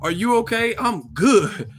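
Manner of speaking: high, smug mock fighter; mock petty boxer. Podcaster mimicking Charlo at an interview, high and mocking. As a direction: taunt the beaten man with a singsong.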